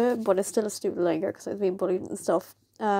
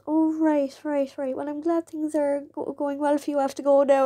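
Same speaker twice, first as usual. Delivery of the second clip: awkward politeness; 5 semitones higher than usual. Retold or imitated. Bully's awkward retold goodbye.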